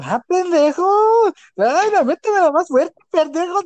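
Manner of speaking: high, hammed-up norteña moaning; playful norteña accent imitation, high-pitched; Monterrey woman's accent. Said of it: "Ah, las de Monterrey".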